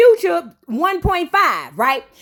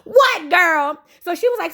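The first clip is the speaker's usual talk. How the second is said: usual speaking voice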